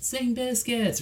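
high, drawn-out pretend holler; mock yell. Co-host mimics yelling a song request at a concert, high mock yell. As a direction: call it out like a fan, without real volume. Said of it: "you'll have to yell".

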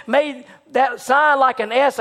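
usual speaking voice

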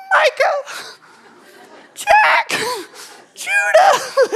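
squeaky, very high little ant calling names; tiny ant voice. Pretend. Dad to his boys, calling names in a tiny ant voice. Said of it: "could hear that little ant voice".

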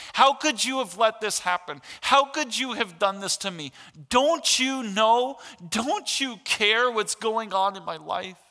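high, loud, slowing anguished questions; pleading cry. Retold or imitated. Pastor voicing hurting people's complaint to God, loud anguished pleading. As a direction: plead with God out of raw grief.

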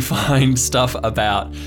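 normal talking voice

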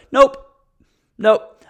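emphatic denial; firm loud nope. Real, not acted. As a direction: shut it down loud.

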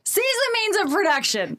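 mock revolutionary rallying cry; high, laughing call to revolution; fiery revolutionary speech. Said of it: "You're on fire already".

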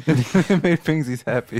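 slow, drawn-out teasing repeat. Retold or imitated. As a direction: drag it out to tease him.

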